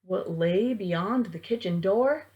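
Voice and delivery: ordinary delivery, nothing marked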